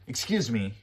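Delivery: rude, confrontational tone; frustrated and impolite. Shown as a demonstration. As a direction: say it like an offended demand. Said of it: "very rude".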